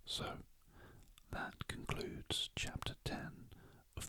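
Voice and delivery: whispered bedtime audiobook narration; whispered story reading. A narrator's reading. Reader lulling listeners to sleep with a mystery chapter, whispering. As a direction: read the story in an unhurried whisper.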